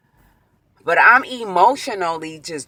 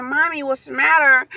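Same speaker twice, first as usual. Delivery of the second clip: lightly voiced child, pitched up; high, concerned question to mother; a hint of a worried kid; 8 semitones higher than usual. Voices child asking crying mother.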